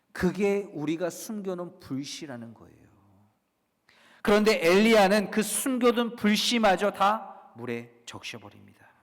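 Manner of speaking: ordinary delivery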